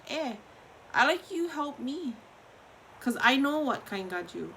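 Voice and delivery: normal talking voice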